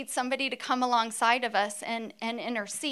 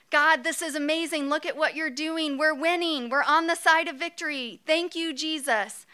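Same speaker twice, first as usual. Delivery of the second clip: hammed-up Moses, higher and eager; Moses impersonation; 4 semitones higher, 3 dB louder than usual. Moses praying on the hill, higher, thrilled. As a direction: Moses cheering God on mid-battle. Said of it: "so here I am as Moses".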